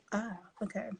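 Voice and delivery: whisper to self. Real, not acted. Reading comment aside.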